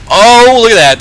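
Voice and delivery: sudden high, loud outburst; startled commentator's shout; excited exclamation. Real, not acted. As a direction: burst out at the big play. Reaction to play in match.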